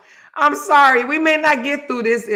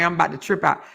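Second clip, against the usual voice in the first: usual speaking voice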